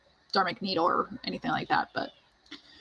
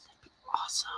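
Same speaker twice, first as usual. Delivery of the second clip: wistful whisper; 10 dB quieter, much faster than usual. Real, not acted. Wishful aside about becoming writer.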